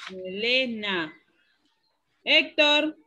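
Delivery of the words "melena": slow "Melena", stress on LE; me-LE-na, drawn out; stressed syllable demo. Shown as a demonstration. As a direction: confirm it by stretching the LE in Melena. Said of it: "¿Cuál es la sílaba tónica?".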